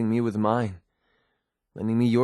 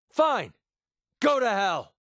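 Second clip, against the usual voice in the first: very high-pitched, angry send-off; furious dismissal; 10 semitones higher, slower than usual. Acted. Cursing a companion who walks away, furious. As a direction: fling the curse after them. Acted quarrel, parting curse.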